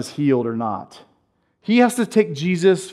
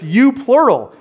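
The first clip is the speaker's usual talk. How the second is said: "you" pushed louder and higher; stress on you; 7 semitones higher, 3 dB louder than usual. Shown as a demonstration. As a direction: lean on "you" as plural.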